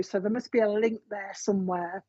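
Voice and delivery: usual speaking voice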